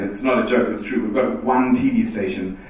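ordinary talk, nothing marked

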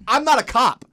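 high, loud exasperated "I'm not a cop"; doctor yelling at lying friends; irritated. Retold or imitated. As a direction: yell it, fed up with the lies. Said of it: "because he's irritated".

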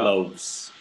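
LOAVES said carefully with a long final s sound; pronunciation model. Shown as a demonstration. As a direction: model Loaves for a learner, drawn-out ending. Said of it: "¿cómo se pronuncia".

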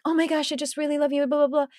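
high, fast mock gush; gushing fan. Podcast viewer meets her, in high fast mock gushing. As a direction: rattle off a fan's adoring rush. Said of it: "going like".

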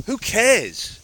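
loud high shout of defiance; defiant shout. Real, not acted. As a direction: throw it out, fed up with caring. Defiant climax of rant.

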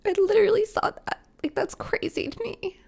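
high-pitched, overcome with real emotion; getting emotional. Real, not acted. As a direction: choke up in awe, voice climbing high. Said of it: "getting so emotional".

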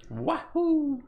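high cartoon plumber whoop; squeaky video game hero yell; Mario cheer.

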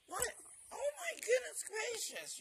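very high, loud delighted squeal; gushing puppy talk. Real, not acted. Owner amazed at happy puppy, gushing puppy talk. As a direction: gush over the puppy.

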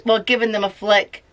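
ordinary delivery, nothing marked

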